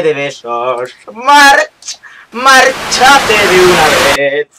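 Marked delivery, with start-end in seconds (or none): 1.1-4.5 high, loud drawn-out call of March; Homer calling Marge. Pretend. Gamer imitating Homer calling his wife, loud and high. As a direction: bellow March like Homer at home.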